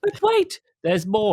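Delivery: sudden high infomercial "but wait"; infomercial pitch. Acted. Host teasing listeners with a mock infomercial pitch. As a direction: hit it like a TV salesman.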